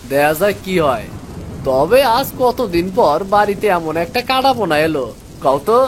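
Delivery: put-on a boy happy about the fish; young son.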